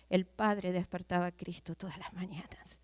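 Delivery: whisper. Acted. Preacher whispers the line.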